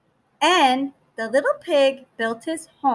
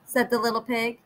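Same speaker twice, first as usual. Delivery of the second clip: plain reading voice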